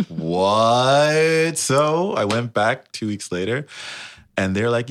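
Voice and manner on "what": louder, higher, long drawn-out what; mock disbelief. Told he'd get paid to DJ, hammed-up disbelief. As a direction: stretch it in comic astonishment.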